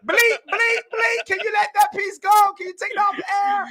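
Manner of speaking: very high, loud, hurried begging; squealing, amused panic; laughing plea. Real, not acted. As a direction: plead through laughter to take it back. Begs to censor his own remark.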